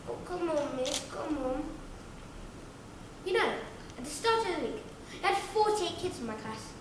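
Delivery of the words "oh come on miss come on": softer pleading whine; whiny kid. Acted. Whiny kid begging miss, quiet whining plea. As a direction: plead under your breath like a pupil. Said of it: "one whiny kid going".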